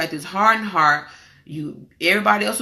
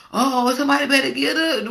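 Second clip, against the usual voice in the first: alarmed relatives; 5 semitones higher than usual. Retold or imitated. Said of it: "They was like".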